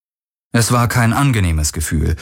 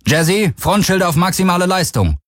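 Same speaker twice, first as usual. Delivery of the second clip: urgent command; 10 semitones higher than usual. A narrator's reading. Captain orders ship computer before attack.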